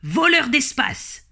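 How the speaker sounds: high, stretched-out acted insult; angry retort. Grey rabbit answering his neighbour's insult, indignant. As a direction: throw the accusation straight back. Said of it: "répliquait Grisou".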